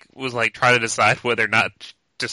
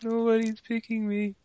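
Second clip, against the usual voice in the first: put-on higher, slow mopey kid voice; sad left-out kid; 10 semitones higher, 3 dB quieter than usual. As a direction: whine it like the last kid on the bench. Voicing the unpicked lonely kid.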